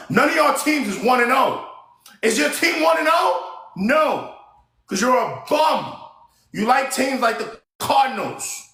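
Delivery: high, shouted sports trash talk. Real, not acted. Trash-talking other fans' football teams.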